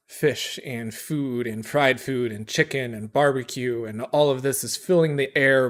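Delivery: ordinary talk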